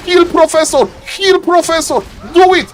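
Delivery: high, insistent coaching cry; anxious caster begging for action; urgent pleading. Real, not acted. Pleading with the player.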